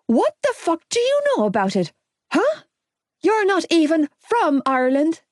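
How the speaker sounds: high, furious yell; shouting. Acted. Gibbsy shouts at the sat-nav on the dashboard. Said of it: "he shouted".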